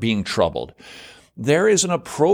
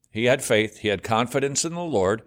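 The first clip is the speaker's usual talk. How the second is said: ordinary delivery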